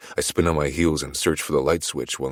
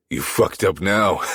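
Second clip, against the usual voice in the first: laughing; 6 semitones higher, 6 dB louder, slower than usual. A narrator's reading. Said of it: "I say through a laugh".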